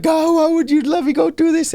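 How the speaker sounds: very high-pitched retold plea; high, wailing question; pleading complaint. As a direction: imitate yourself crying out to God.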